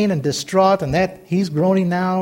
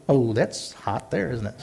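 normal talking voice

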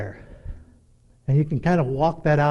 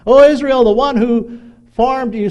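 high, loud prophetic proclamation; proclaimed scripture reading; 7 semitones higher, 7 dB louder than usual. Acted. Preacher reading Isaiah to congregation, high ringing proclamation. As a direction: declaim it like a herald, pitched up.

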